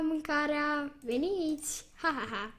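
ordinary talk, nothing marked

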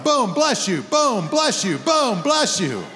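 boom, bless you hit hard each time; punched blessing. Retold or imitated. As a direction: fire off each blessing like a shot. Mock-blessing each sneeze emphatically.

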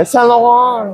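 SAINT LAURENT drawn out slowly; showy correct pronunciation. Shown as a demonstration. Friend proves she can pronounce unaffordable brands, slow showy SAINT LAURENT. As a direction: show off SAINT LAURENT, stretching the last syllable. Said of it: "I know how to pronounce it".

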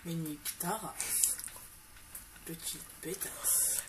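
murmurer l'insulte lentement; chuchoter, lent et méprisant; insulte marmonnée. Real, not acted. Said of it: "Arrête de dire de gros mots". (insulte chuchotée)

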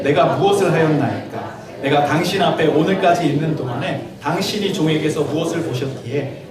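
slow, solemn Bible reading voice; scripture reading. Acted. Pastor reading scripture to the congregation, slow and solemn. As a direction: read each verse out deliberately, like from the pulpit. Reads the chapter aloud.

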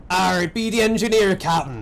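lightly voiced West Country pirate talk. Pirate crewman chatting with the captain, in pirate voice. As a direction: growl it out like an old pirate.

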